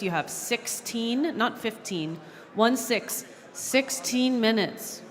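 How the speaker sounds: slow, spaced-out sixteen, held on the second syllable; careful clarification. Real, not acted. Chair making the time limit unmistakable, sixteen slowly spelled out. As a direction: enunciate sixteen slowly, setting it apart from fifteen.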